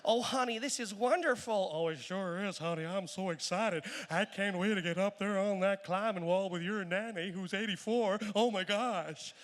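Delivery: lightly American tourist chatter; hint of an American accent, eager; American couple. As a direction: gush like an excited American couple. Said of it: "the Americans say".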